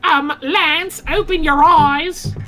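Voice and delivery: high, drawn-out Australian squawk; squeaky Australian conscience voice; slow, piercingly high Australian; Australian accent. Acted. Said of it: "I'll do Australian".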